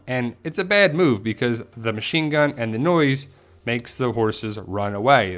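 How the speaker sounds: normal talking voice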